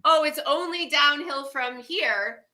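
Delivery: ordinary talk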